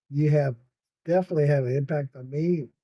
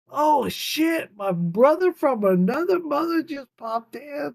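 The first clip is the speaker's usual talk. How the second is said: high excited greeting; thrilled welcome to a buddy; 9 semitones higher than usual. Real, not acted. Excited greeting to arriving viewer.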